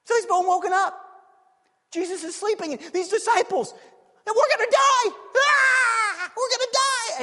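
high, loud hammed-up panic; mock terrified cry, shouting; hammed-up cry of doom; panicked disciples.

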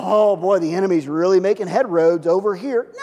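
a God fretting over the enemy; alarmed God. Retold or imitated. Preacher mocking an anxious God, loud mock alarm. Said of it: "God's not sitting there going".

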